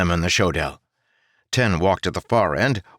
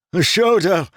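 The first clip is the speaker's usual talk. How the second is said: high, loud scream of horror; horrified scream; 12 semitones higher, 6 dB louder than usual. Acted. Gavin screaming back to Ten about the enemy, horrified. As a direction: scream it in terror. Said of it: "Gavin screamed back, horror in his voice".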